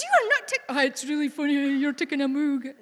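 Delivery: high, loud mock-indignant protest; retold indignation. Daughter to mum who photographed her moog, retold indignation.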